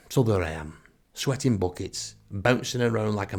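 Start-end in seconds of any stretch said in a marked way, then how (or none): none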